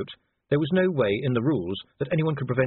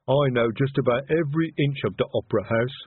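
troll breezily showing off culture; airy troll; 3 semitones higher, 3 dB louder than usual. A narrator's reading. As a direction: answer airily in a lumbering troll voice. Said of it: "said Detritus airily".

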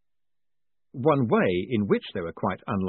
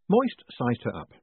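ordinary delivery, nothing marked

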